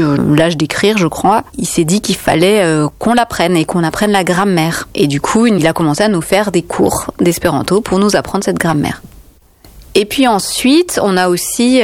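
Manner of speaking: normal talking voice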